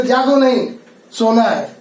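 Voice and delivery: जागो नहीं joined, a break, then सोना है; pause after नहीं. Shown as a demonstration. Teacher gives another comma example, pausing after नहीं. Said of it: "इस प्रकार से कुछ और भी है".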